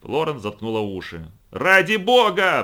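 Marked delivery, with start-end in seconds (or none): 1.5-2.6 slow, high, loud cry; shouting. A narrator's reading. Florence shouting at uncle over the noise, loud cry. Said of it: "прокричала она в свою очередь".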